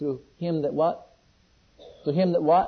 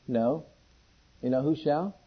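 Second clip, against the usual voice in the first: ordinary delivery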